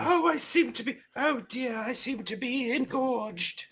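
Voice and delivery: a fluttering genteel spinster, scandalised; Miss Marple. Pretend. Mocking his co-host as a prim old lady. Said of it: "He just turned into Miss Marple".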